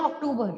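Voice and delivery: अक्टूबर said as English "ok-TOE-ber" or "ok-TOO-ber"; English pronunciation. Shown as a demonstration. Teacher quoting the English word, English-sounding अक्टूबर. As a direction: open अक्टूबर with "ok" and a hard English t. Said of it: "English में हम बोलते हैं".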